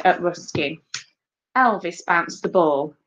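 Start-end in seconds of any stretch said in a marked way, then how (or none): none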